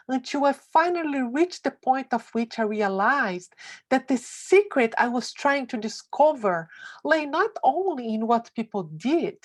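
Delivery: usual speaking voice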